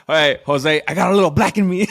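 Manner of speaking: loud and fast, bursting with laughter; laughing shout. Real, not acted. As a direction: yell it through a laugh at your friend. Loud laughing joke at friend.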